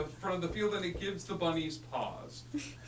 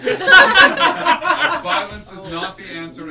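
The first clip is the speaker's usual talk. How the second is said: laughing; 7 semitones higher, 21 dB louder, much slower than usual. Real, not acted. Laughs through the narration.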